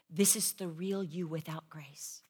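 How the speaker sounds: a hushed unvoiced whisper; whispered voice of God. Preacher voicing God's gentle rebuke to her, whispered. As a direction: breathe it out softly, like a quiet word from God.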